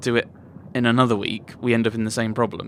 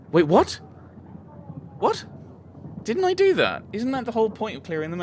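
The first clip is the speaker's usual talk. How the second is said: sudden jump up in pitch, baffled; real startled disbelief; surprised; 11 semitones higher than usual. Real, not acted. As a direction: caught off guard, voice leaping high. Player surprised by event.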